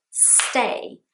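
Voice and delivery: "stay" opening on a hissed S; S at beginning. Shown as a demonstration. Teacher models the S start, one-syllable "stay". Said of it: "silent S at the beginning".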